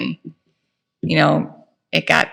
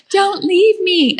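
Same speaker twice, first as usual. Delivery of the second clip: high, slow begging whine; mock-pleading high, slow voice; pleading daughter-in-law; 13 semitones higher, slower than usual. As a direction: drag out the plea to stay. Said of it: "And she's like".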